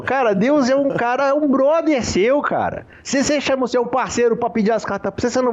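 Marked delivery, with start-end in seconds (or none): none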